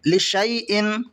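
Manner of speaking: लिशाइन said "li-shay-in"; correct silent alif. Shown as a demonstration. Teacher shows how to read it, "shay" with alif silent. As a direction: glide "shay" in लिशाइन, no long "aa". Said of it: "इस तरह पढ़ेंगे".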